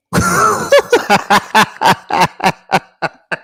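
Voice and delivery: big shrieking laugh; loud high laughter. Real, not acted. Co-host cracking up at his own hug joke, loud laughter. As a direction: burst out laughing.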